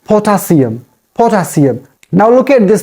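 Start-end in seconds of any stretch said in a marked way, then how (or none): 0.1-0.8 "potassium" with a clear s sound; corrected potassium. Shown as a demonstration. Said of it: "It is potassium". 1.1-1.9 "potassium" with a clear s sound; corrected potassium. Shown as a demonstration. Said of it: "It is potassium".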